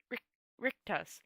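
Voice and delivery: plain reading voice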